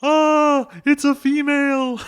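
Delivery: high, loud, horrified shriek of a character; mock fearful scream. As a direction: scream it in hammed-up panic. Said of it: "retreats in fear, and screams".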